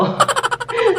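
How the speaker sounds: quick high falling laugh; short amused laugh. Real, not acted. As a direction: laugh at your own punchline. Joke about losing weight.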